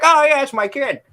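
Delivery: high, loud mock British accent for a dad; surly British man. Coworker retelling meeting John's dad, mock British accent. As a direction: ham up a gruff British dad. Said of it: "My dad does not sound like a surly British man".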